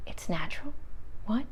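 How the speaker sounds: whispered disbelief; stunned whisper. Retold or imitated. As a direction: re-enact being quietly dumbfounded. Acting out own stunned disbelief.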